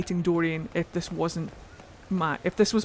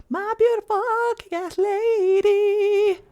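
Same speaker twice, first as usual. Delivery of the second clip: much higher, louder, drawn-out fangirl squeal; over-the-top adoring squeal; gushing squeal; 12 semitones higher, 4 dB louder, slower than usual. Real, not acted. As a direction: squeal it out in delight. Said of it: "whilst I gush over my friends proudly".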